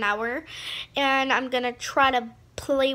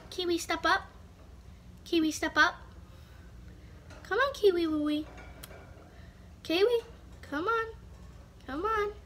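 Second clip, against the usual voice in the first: higher, soft sing-song coaxing; coaxing a pet; 4 semitones higher, 6 dB quieter, faster than usual. Real, not acted. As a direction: gently coax the budgie onto your finger. Coaxing the bird onto her finger.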